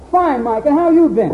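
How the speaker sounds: high, loud cheery answer; friend's reply. Retold or imitated. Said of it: "And he says".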